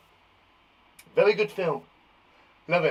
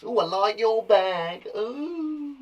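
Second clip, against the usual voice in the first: slow gushing compliment, ooh higher; admiring a bag; 5 semitones higher, much slower than usual. Shown as a demonstration. Teacher showing how she compliments bags, delighted. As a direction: coo over a friend's nice bag. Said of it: "That's how I say to people".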